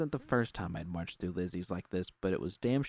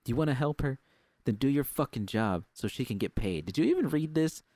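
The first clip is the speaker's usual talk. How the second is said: louder, higher scolding boss; angry berating; 6 semitones higher, 4 dB louder than usual. Acted. Susie scolding Judy to do her job, angry. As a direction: hammer her with each question.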